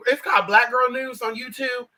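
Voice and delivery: ordinary talk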